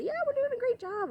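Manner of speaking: very high, bright praise; encouraging pet-talk. Shown as a demonstration. Trainer bolstering a worried dog with very high praise. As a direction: cheer the shy dog on. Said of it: "but I try to say".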